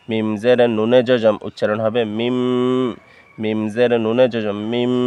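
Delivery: মিম ending in a long held m; N read as M. Shown as a demonstration. Teacher repeating the rule's result, মিম with held m. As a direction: draw out the last m of মিম. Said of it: "নুনে জজমের জায়গায় মিমে জজমের উচ্চারণ".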